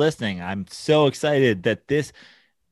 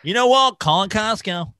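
radio DJ voice; 7 semitones higher than usual. Retold or imitated. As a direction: announce it like a hyped radio host. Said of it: "sound like a morning radio D J".

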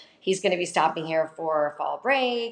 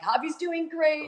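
high, slow and bright as a caption; cheery quoted friends; 11 semitones higher, 4 dB louder, slower than usual. Retold or imitated. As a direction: play the friends gushing cheerfully. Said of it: "and they're like".

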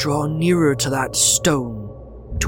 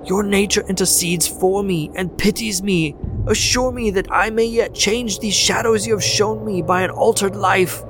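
agonized plea; 9 semitones higher than usual. A narrator's reading. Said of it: "In his agony".

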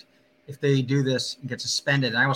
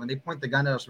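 normal talking voice

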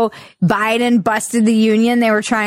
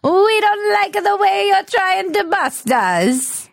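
put-on high, drawn-out Italian accent slipping toward Caribbean; slow mock mobster, drifting into Caribbean lilt; Italian accent veering Caribbean; 9 semitones higher, slower than usual. Mobster warning Biden, Italian accent veering into Caribbean. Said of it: "veering a little bit into a Caribbean accent".

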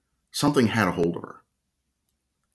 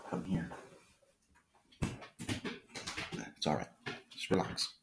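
much softer, gentle calming of a nervous dog; soothing the dog; 3 semitones lower, 15 dB quieter than usual. Real, not acted. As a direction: settle the dog down, hushed and kind. Calming his barking dog.

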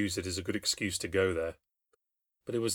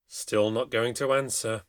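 usual speaking voice

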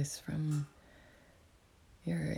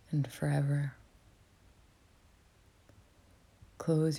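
whispering. Real, not acted. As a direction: whisper it gently, like guiding a meditation. Whispers throughout to guide meditation.

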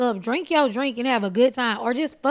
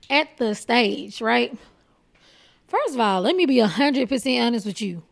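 ordinary talk

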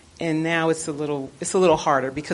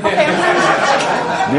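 high, slow, laughing sorry; laughing apology; 10 semitones higher, 6 dB louder, slower than usual. Real, not acted. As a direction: apologize while cracking up.

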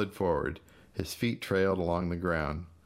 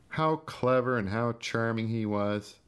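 sneering mimicry; 5 semitones higher than usual. Gus sneering at praise.

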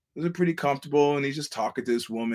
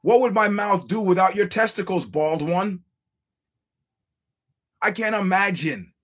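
sarcastic fawning; 6 semitones higher, 4 dB louder than usual. Retold or imitated. Mocking fans' sycophancy.

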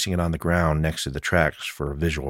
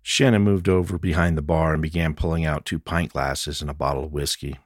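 ordinary delivery

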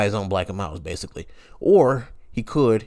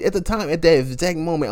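ordinary delivery

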